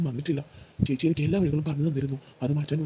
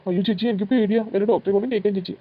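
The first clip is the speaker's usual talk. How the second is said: scared pleading; 4 semitones higher, 5 dB louder than usual. Retold or imitated. Boy pleads, scared of treatment.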